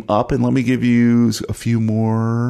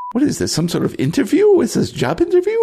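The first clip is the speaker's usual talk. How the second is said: much higher-pitched, incredulous questioning; mock incredulity. Player balking at a supposed job interview, mock incredulous. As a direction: squeak out the questions in mock disbelief.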